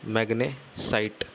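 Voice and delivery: drawn out slowly; slow dictation. Shown as a demonstration. Teacher dictating magnesite to students, slowly. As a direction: draw the word out so the class can copy it.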